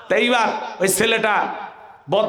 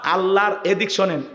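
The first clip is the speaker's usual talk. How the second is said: ordinary talk, nothing marked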